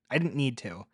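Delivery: plain reading voice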